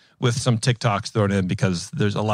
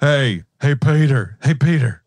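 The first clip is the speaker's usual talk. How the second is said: a rough try at a Patrick Warburton voice, a little louder; imitating Patrick Warburton by calling to Peter; admittedly poor Patrick Warburton impression; 2 semitones higher, 3 dB louder, slower than usual. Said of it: "I can't do Patrick Warburton's voice".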